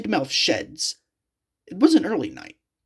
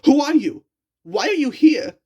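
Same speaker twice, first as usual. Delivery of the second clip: much higher, louder frightened cry; afraid; 10 semitones higher, 5 dB louder than usual. A narrator's reading. Captured engine cries out at stranger, higher frightened shout. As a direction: cry out in fear. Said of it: "said James, afraid".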